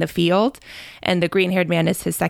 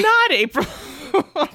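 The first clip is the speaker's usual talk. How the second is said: words squeezed out through laughter; laughing; 13 semitones higher, much slower than usual. Real, not acted. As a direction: laugh while you say it, slow.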